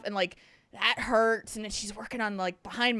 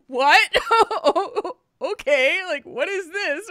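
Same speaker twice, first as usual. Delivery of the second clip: laughing; 4 semitones higher, 6 dB louder, much slower than usual. Retold or imitated. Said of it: "I'm like".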